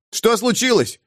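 high alarmed call. Acted. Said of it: "Смирт окликнул".

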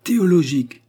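s'écrier plus haut et plus fort, dans un effort désespéré; cri désespéré. A narrator's reading. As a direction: arracher le mot d'un seul élan, fort et haut. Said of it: "s'écria mon ami en faisant un effort désespéré". (le mot enfin trouvé, lancé avec effort)

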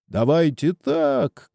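high, drawn-out narrated call; mild crowd shout. A narrator's reading. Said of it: "кричат одни". (протяжный негромкий выкрик)

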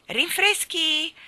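high, loud, slow butler call; drawn-out announcement of "Rinfreschi"; butler announcing. Acted. Maggiordomo annuncia i rinfreschi. (annuncio del maggiordomo alto e lento)

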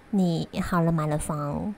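ordinary talk

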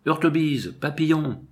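high, drawn summons; calling out. A narrator's reading. Chief summons guards from downstairs, calling out high. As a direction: call two names as if down a stairwell. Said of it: "Et il appela".

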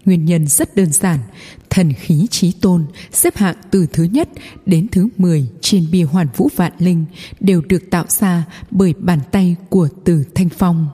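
plain reading voice

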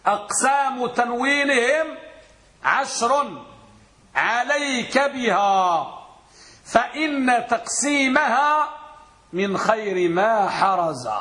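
slow, chanted verse recitation; recited verse. Shown as a demonstration. Teacher chanting a verse to his students, slow and measured. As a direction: intone the poem line slowly. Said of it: "قل بيت".

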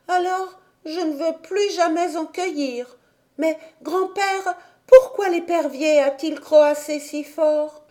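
put-on a wondering little girl; little girl Heidi. A narrator's reading.

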